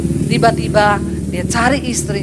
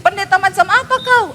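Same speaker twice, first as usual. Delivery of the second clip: higher, pressing demand of an annoyed husband; mock angry husband; 7 semitones higher than usual. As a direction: challenge her like a fed-up husband. Angry husband on phone retold. (suami marah pura-pura)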